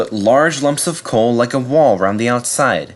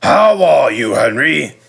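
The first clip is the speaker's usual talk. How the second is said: fat controller; 4 semitones higher, 5 dB louder, slower than usual. Acted. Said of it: "the fat controller came out".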